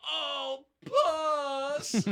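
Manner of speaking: high, loud mock moan; sexy moan voice. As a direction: ham up a bedroom moan. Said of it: "being like".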